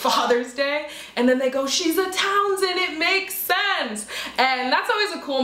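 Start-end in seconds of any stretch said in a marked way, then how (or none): 1.7-4.1 high, drawn-out mock gushing; slow singsong imitation of approving fans. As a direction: stretch every word up high like an admiring fan. Retold fans' admiring comments.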